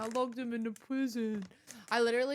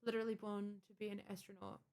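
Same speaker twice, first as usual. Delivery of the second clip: ordinary talk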